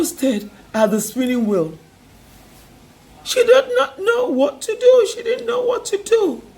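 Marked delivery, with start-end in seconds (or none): 3.2-6.4 despairing. A narrator's reading. Narrating the daughter's despair.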